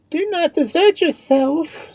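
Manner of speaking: very high, loud female character voice; impassioned plea to take courage; urging confidante. A narrator's reading. Marianne urges the despairing Queen.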